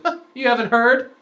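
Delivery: mock-smarmy, knowing question. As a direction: imitate a smug know-it-all with gossip. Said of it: "he was so smarmy".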